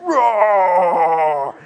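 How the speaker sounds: long, very loud roar; mock screaming roar. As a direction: bellow like a monster in someone's face. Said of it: "after he finishes screaming at me".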